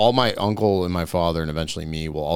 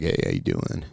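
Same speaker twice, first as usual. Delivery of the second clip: much lower creaky surfer hello; vocal fry; 4 dB quieter than usual. Shown as a demonstration. Showing a friend surfer-boy talk at the beach in vocal fry. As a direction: drop into a lazy fried greeting. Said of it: "Vocal fry".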